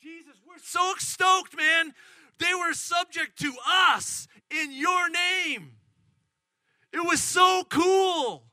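high, drawn-out excited shouting; stoked. Acted. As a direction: shout it like thrilled disciples bursting with news. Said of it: "they were so stoked".

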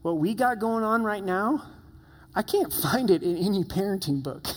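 lightly hammed-up parental exasperation; overwhelmed parent. Parent finding no answers in parenting books, mock overwhelmed. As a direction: act out a parent at a loss, pitch lifted. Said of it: "you're so overwhelmed because you're like".

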